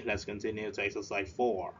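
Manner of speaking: ordinary talk, nothing marked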